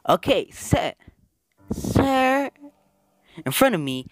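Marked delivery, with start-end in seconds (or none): 1.7-2.5 a high voiced girl. Gamer voicing Ita, high girl voice. Ita speaks in the visual novel.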